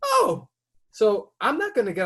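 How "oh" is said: a sudden very high startled oh; surprised oh. Retold or imitated. Seeing the driver raise his hands, startled and high. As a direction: caught off guard, jump right up in pitch. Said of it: "I'm like".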